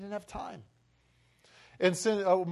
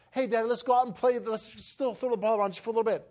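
put-on higher, quick boyish request; eager ten-year-old; 6 semitones higher, faster than usual. Boy with ball and glove asks dad, eager child. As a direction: voice a kid asking Dad to play, quickly. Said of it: "the ten years old".

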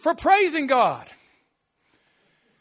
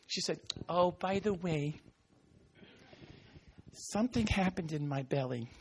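mock mumble; 8 semitones lower, 13 dB quieter than usual. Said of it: "what did she do?".

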